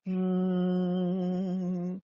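steady, level humming; fridge hum. A narrator's reading. Kitchen refrigerator humming steadily at night. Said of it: "went the refrigerator".

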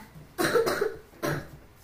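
high, loud pretend coughing; put-on sickly coughs; fake cough. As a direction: cough theatrically as if ill. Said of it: "So much faking is there".

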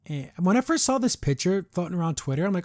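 ordinary talk

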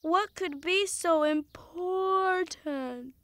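soft, dragging drowsy question; groggy and very slow; sleepy grumble. Acted. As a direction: stretch it out as if half-asleep. Woken up, reluctant.

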